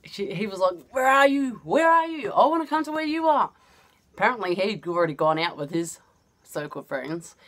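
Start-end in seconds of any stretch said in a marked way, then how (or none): 0.9-1.6 high, nagging mimic of the ex; pestering ex. Ex on phone, pestering. 1.6-2.3 higher, more insistent nagging mimic; pestering ex, pushed higher. Ex on phone, pestering. 2.3-3.5 high, pleading mimic of the ex; needy ex. Ex on phone, pestering.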